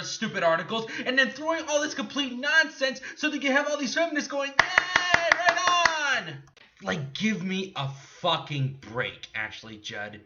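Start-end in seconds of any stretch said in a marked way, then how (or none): 4.5-6.4 high, stretched mock cheer; squealed sarcastic hooray. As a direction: imitate an over-eager crowd, sarcastically. Mimics feminists cheering.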